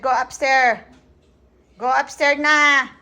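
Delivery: firm, loud order with stretched ending; insistent, drawn-out call; loud parental command. Real, not acted. Mother repeats order after being ignored.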